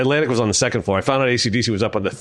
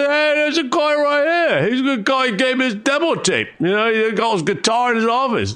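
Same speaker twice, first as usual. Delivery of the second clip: [name]'s voice. Retold or imitated. Singer vouching for the young fan, much higher imitation. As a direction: talk him up like an old mate. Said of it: "And [name] goes".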